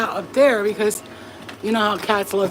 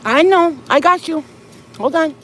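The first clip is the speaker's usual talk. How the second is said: high loud cooing to cats; squeaky pet-talk reassurance; 8 semitones higher, 7 dB louder than usual. Real, not acted. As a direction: coo over the meowing cats. Soothing hungry cats.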